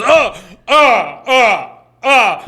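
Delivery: loud high repeated "oh"; mock recoil from a smell in loud, high cries; acted-out gagged "oh"s; disgusted reaction. Acting out clerk smelling it.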